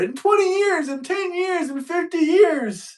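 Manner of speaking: whiny prophet voice, high and slowing; climate alarmist. Retold or imitated. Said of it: "they've been saying it's gonna be underwater".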